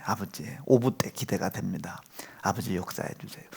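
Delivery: hushed whispered plea to God; whispered prayer. Retold or imitated. Father silently praying in church for his son, whispered. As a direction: breathe the prayer under your breath.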